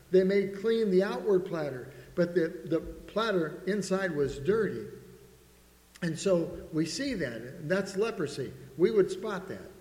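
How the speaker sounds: a pastor driving the point home to his congregation, fervent; preaching at full force. Acted. Lift it and preach it hard.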